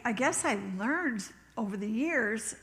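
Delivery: usual speaking voice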